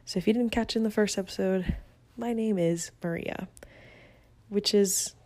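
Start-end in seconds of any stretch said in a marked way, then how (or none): none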